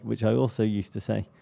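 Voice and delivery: ordinary delivery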